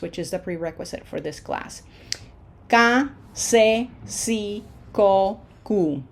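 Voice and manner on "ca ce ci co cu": "Ka, se, si, ko, ku" as an evenly spaced drill; Spanish pronunciation demo. Shown as a demonstration. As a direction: recite "Ka, se, si, ko, ku" with pure Spanish vowels. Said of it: "make the sounds".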